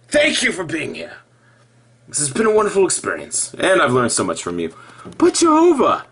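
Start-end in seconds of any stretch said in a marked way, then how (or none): 5.2-6.1 mock plea to Jehovah. Calling out to God.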